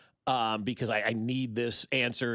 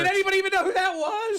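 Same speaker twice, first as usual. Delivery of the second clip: incredulous; 6 dB louder, faster than usual. Real, not acted. Amused incredulous question.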